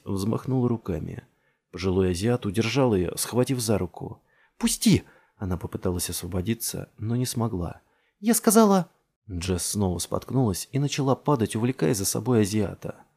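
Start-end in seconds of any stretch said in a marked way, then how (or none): 4.6-5.0 high, loud demand; urgent high-pitched yell; struggling protest. A narrator's reading. Struggling in the man's grip. 8.2-8.9 high, loud, broken-off demand; urgent high yell; insistent protest. A narrator's reading. Struggling in the man's grip.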